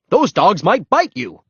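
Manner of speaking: loud, high urgent warning; demanding. A narrator's reading. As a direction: scold him with a warning. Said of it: "he demanded".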